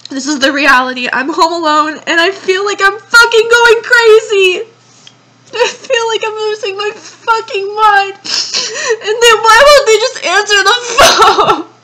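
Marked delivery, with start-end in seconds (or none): 2.1-11.7 louder, higher, cracking with tears; crying out in real desperation; distraught crying. Real, not acted.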